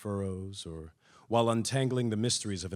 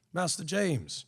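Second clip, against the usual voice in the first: a hint of a deferential old man, higher; lightly voiced old slave addressing master; old slave greeting; 7 semitones higher, slower than usual.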